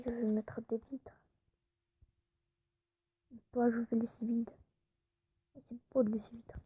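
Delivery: soft unvoiced narration of her build; whispered commentary. Vlogger narrating her Minecraft build in a hushed whisper. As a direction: whisper each step to the viewers.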